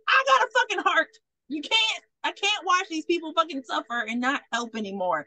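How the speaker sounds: mock heated heartfelt rant; passionate outburst. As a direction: blurt it from the heart.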